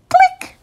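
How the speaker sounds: high bright camera click. Acted. Reader makes the camera's click sound. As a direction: chirp the click like a tiny shutter.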